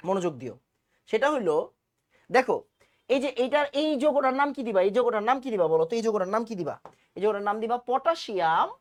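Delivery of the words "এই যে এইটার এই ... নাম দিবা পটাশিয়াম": ordinary talk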